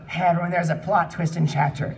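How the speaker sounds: ordinary talk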